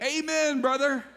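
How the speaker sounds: high, hollered amen; imitated over-eager congregant yelling; as loud as possible. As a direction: shout it from the back row. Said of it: "say it as loud as he could".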